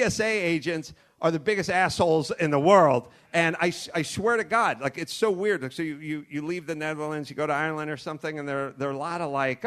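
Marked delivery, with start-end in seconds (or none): none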